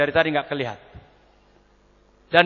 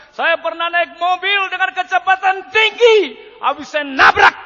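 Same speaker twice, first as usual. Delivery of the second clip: high shouted confession, slowly building; loud and high, stretched out in anguish; imitated hysterical wife; 13 semitones higher, 10 dB louder than usual. Wife telling the pastor about her crash, hysterical. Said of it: "Ini kok histeris terus".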